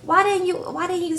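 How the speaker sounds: high, upset mock panic; freaking out. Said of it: "Andrea starts freaking out".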